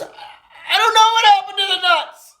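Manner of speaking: very high, stammered mock hysteria; frantic wail. Little Johnny answering his mom about the nuts, hysterical.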